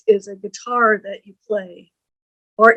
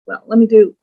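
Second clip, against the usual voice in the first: ordinary delivery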